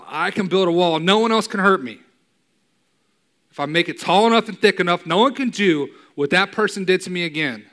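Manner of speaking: rising preacher's shout; preaching shout. Acted. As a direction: shout it like the hurt voice that walls itself off. Preaching climax, building a wall.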